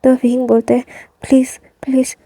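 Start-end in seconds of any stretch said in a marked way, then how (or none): 1.2-2.2 breathless whisper, begging; frightened whispered plea. Acted. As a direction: plead in a scared whisper. (डरा हुआ wing लैनसन से फुसफुसाकर गिड़गिड़ाता है)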